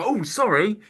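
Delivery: high-pitched mock "oh, sorry"; imitated startled apology. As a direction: play someone caught off guard apologizing. Acting out the girl's startled apology.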